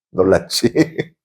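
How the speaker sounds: high, loud, slow shout with a laugh; jump-scare the class, then laugh; high playful shout to startle. Acted.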